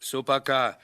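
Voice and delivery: a firm shout to end the argument; calling for order. Acted.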